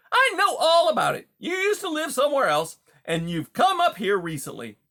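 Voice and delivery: high, loud, hearty salesman-like patter; brash, chummy beaver. Acted. Beaver greets a wary mouse as a new pal, brash and chummy. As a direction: play a pushy, overfamiliar beaver, loud and high.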